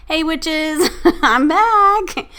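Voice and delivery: high, loud, laughing hello; excited greeting. Real, not acted. Host back after a long absence greets her witches, excited.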